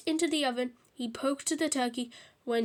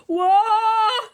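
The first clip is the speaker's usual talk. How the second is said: drawn-out, much louder and higher howl; screech; 8 semitones higher, 11 dB louder than usual. A narrator's reading. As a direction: screech like you've been slapped. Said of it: "screeched Henry".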